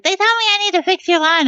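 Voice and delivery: high-voiced little fella; squeaky-high quoted workman. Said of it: "gave this person a higher pitched voice".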